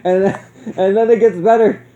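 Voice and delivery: high, laughing out the words; cracking up mid-sentence; can barely get it out for laughing. Real, not acted. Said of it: "I was dying the entire time".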